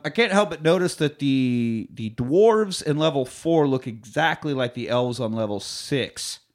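usual speaking voice

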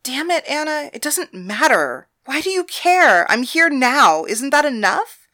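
cornered companion lashing out at a clingy lover; exasperated outburst. Acted. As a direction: throw the question back at her in irritation. Thalia snaps back at lover.

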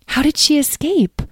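high-pitched, wondering question; lightly voiced eager asker; curious questioner. Edwene asks how she escaped, curious.

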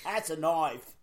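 put-on Aussie catchphrases; mock Australian accent. Co-host showing all the Australian she knows, mock accent. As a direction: list clichés in a fake Australian accent. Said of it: "all the Australian I know".

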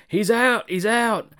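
urgent, high-pitched mock commentary yell; imitated shout. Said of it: "J R shouts".

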